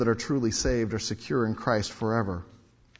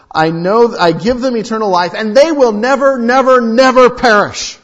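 loud rising proclamation; emphatic preaching; 10 semitones higher, 14 dB louder than usual. Shown as a demonstration. Preacher proclaiming the promise to the congregation, building loud. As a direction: hammer each never harder. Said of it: "it's like putting exclamation point".

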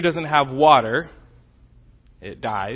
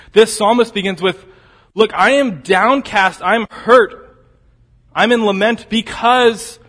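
higher, louder, driving sermon voice; full-force preaching; 7 semitones higher, 7 dB louder than usual. Acted. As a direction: preach it out with rising fire. Preacher voices the lament loudly.

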